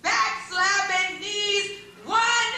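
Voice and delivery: ordinary talk, nothing marked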